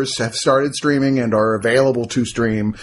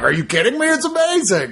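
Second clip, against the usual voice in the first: excited horror fan; 10 semitones higher than usual. Retold or imitated. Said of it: "are like".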